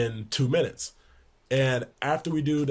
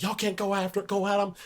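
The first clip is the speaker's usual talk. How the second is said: high-pitched mock coach yell; imitating yelling coach; 8 semitones higher than usual. Coach Barnes yelling at the players, high mock yell. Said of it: "He's like".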